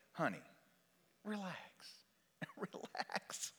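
very soft, near-whispered coaxing; calm the patient down quietly; acted gentle hush; hushed soothing.

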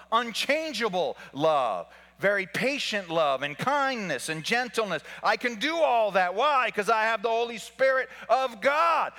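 loud, high, driving sermon delivery; full-force preaching. Acted. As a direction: preach it out to the whole church.

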